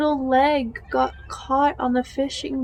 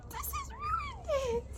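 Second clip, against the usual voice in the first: high, strained tearful squeak, much softer; words squeezed out through real sobbing; broken-hearted crying voice; 8 dB quieter than usual. Real, not acted. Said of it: "start crying on video".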